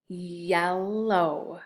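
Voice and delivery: Yellow drawn out with a held l; slow, lingering Yellow; stretched out. Shown as a demonstration. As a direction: stretch Yellow so each sound shows. Teacher stretches word for spelling.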